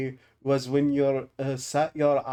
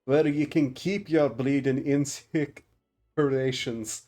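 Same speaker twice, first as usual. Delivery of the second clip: put-on half-hearted Scottish dwarf accent with a scoff; stupid accent. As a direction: sneer it in a put-on gruff Scottish brogue. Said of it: "I should stop doing that stupid accent".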